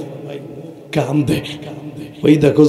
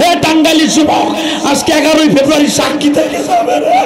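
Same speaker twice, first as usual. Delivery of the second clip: high, urgent preaching that builds to shouts; impassioned sermon at full force; fiery preaching; 10 semitones higher, faster than usual. Real, not acted. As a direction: drive the crowd to run and weep.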